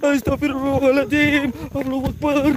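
high-pitched frightened invocations; panicked praying. Real, not acted. Ghost hunter calls on God after seeing pocong, panicked. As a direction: blurt prayers high, in fright.

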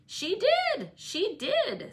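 put-on very high, loud childish chant; gleeful high playground jeer; sing-song taunt. A narrator's reading. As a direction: crow it to wind her up. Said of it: "sang Linton".

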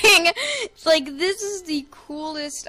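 bursting into giggles; laughing. Real, not acted. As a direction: laugh through the words. Delighted laugh at coolest skin.

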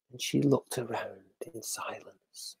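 hushed forest quiet; whispering. A narrator's reading. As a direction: whisper as if in silence. Silence in forest.

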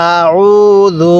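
আউযু very slow, middle vowel held level; one-alif elongation. Shown as a demonstration. Teacher asking learner to repeat along, drawn-out আউযু. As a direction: chant আউযু slowly, stretching the উ. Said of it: "এক আলিফ টানতে হবে".

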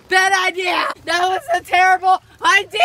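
loud, high, amused self-scolding; loud laughing dismay. Real, not acted. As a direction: shout it out between laughs. Laughing at a failed attempt.

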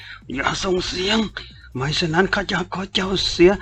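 pinning a captive and threatening him; menacing threat. A narrator's reading. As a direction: warn him not to make a sound. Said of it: "กดเสียงต่ำพูดข่มขู่".

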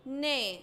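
"نا" sounded as one lone syllable; short fatha sound. Shown as a demonstration. Teacher shows noon with fatha, "نا" sounded out. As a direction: say "نا" as noon with an a vowel. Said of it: "النون عليها فتحة أنطق وأقول".

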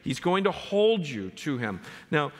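high, ringing exhortation to rejoice; rousing preaching. Acted. Pastor urging believers to rejoice and trust God, high and rousing. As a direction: lift the congregation up, full voice.

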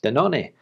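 Danone the Spanish way, da-NO-neh; Spanish pronunciation. Shown as a demonstration. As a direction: give Danone three syllables, stress in the middle. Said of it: "heard it pronounced in Spanish".

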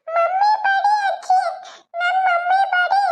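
put-on high, slow tearful child wail; crying child. Little girl crying to grandma that mummy hit her.

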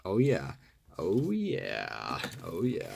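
low, gravelly early-morning croak. Real, not acted. Collector hearing his early-morning voice, gravelly and low. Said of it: "That's gravelly".

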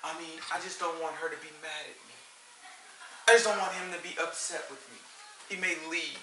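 soft, timid parent voice; hushed, meek mock parent; scared parent. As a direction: quietly imitate a parent afraid to upset the child. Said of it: "Some of 'em scared of their kids just like".

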